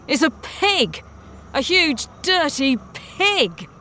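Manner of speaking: disgusted disbelief. A narrator's reading. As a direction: exclaim it in disgust, drawing out the last words slowly. Captain scorns the animal.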